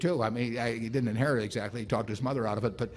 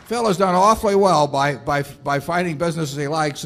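louder and higher, proudly presenting a guest to the hall; beaming, raised-voice announcement of a hero; excited introduction; 5 semitones higher, 7 dB louder than usual. Real, not acted. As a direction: call out his name to the crowd with delight. Introducing honored guest to crowd.

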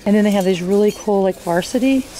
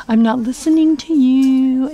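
louder, slower teasing brush-off; playful retort; 5 semitones higher, 5 dB louder than usual. Real, not acted. Vlogger teasing someone nearby, louder and slower. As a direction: tease someone off-camera. Playful aside, loud and slow.

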